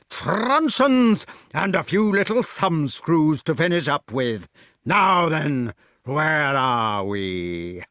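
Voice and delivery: drawn-out gloating giant voice; threatening giant. A narrator's reading. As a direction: relish each threat, slowing at the end. Giant relishes his threat.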